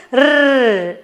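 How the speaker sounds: long, high trilled "rrr"; rolled R sound. Shown as a demonstration. Teacher having children say it again, long rolled R. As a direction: roll the R out long. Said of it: "Say it again".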